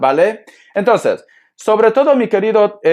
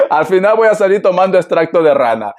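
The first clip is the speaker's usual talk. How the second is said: words broken by real laughter; laughing; 3 semitones higher than usual. Real, not acted. Reader jokes about drinking frog extract, laughing through it.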